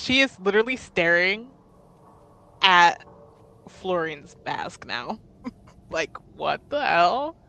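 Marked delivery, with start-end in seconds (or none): none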